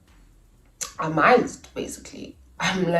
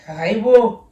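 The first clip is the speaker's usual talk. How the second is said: louder, higher incredulous exclamation; a loud, higher South African exclamation of disbelief; shocked haibo; 5 semitones higher, 10 dB louder than usual. Retold or imitated. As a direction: react in disbelief. Shocked at the platter.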